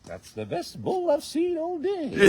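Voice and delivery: high, slow, drawn-out Scottish impression; loud, high-pitched attempt at a Scot; Scottish accent. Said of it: "Do it in a Scottish accent".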